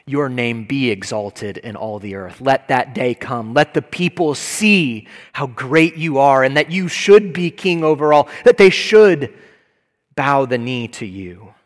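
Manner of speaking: crying out for God's kingdom to come; fervent prayer. Acted. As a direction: pray it with mounting fervor. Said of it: "we say with God".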